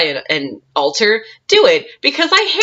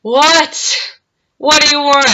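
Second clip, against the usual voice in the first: higher, louder retold irritation; mock fed-up "what", pitched up; exasperated reply; 8 semitones higher, 4 dB louder than usual. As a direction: answer a pest who won't give up. Second reply to persistent man.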